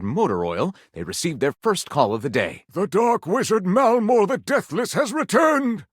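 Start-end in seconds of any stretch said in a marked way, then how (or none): 2.7-5.8 higher, slow grand proclamation; slow, high dramatic warning; dramatic exclamation. A narrator's reading. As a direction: announce doom like a wizard. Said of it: "Gondorf exclaimed".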